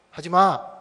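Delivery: put-on loud, firm warning to a child; firm scolding. Father tells his daughter to stop pushing the swing, firm and loud. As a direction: tell the child to stop, loudly. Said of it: "이랬거든요".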